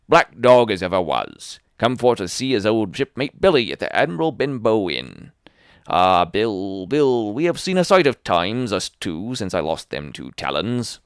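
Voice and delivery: put-on loud, wheedling old sea dog; sly old sailor. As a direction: coax the boy like a fawning pirate.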